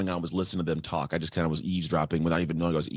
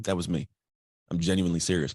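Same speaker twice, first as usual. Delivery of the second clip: ordinary talk, nothing marked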